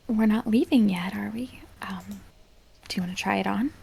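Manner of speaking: soft hushed whisper. Acted. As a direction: whisper gently. Whispering near sleeper.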